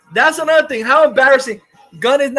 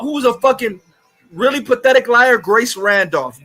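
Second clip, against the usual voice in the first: usual speaking voice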